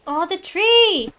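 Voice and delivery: high, loud, drawn-out cry; big slow "oh, look!" exclamation; delighted exclamation. Real, not acted. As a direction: stretch it out on a rising swell of delight. Exclaims on seeing the tree.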